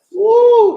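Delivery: loud high whoop of hype; hype shout. Real, not acted. Cheering the verse, a loud high woo. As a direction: let out a big excited woo.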